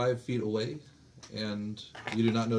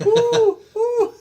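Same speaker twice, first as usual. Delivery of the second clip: high, loud laughing woo woo; bird call; 14 dB louder than usual. Pretend. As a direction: hoot like a bird. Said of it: "Make a bird call".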